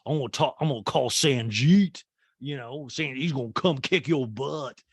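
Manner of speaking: light Southern sass. Pretend. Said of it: "I'd be like".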